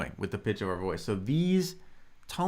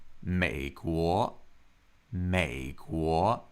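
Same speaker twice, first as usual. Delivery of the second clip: "美国" slowly, low first syllable, rising second; third tone, second tone; 6 semitones lower, much slower than usual. Shown as a demonstration. Teacher answering how to say it, slow low-then-rising "美国". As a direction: keep 美 of "美国" low, then lift 国. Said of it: "That's third tone, second tone".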